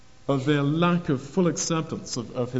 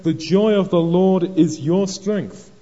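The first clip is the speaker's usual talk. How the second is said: slow, loud, high proclamation; rousing encouragement; 4 semitones higher, 6 dB louder, slower than usual. Retold or imitated. Ezra rousing the sorrowful people, slow emphatic encouragement. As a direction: proclaim it slowly to lift their spirits. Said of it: "Ezra encourages them".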